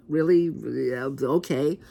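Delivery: unconvinced, slowing down as you concede; skeptical reaction. Acted. Reviewer reacting to another Romulan storyline, skeptical.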